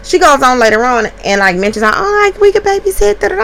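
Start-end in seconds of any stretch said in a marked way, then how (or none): 1.9-3.4 high, sing-song mimic of the other mom; lightly mocking impression of the other mom; mock sweet mom voice. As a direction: mimic her chirpy offer.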